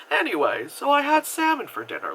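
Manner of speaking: breezy topic change. Retold or imitated. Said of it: "not exactly in that tone, but very much kinda like".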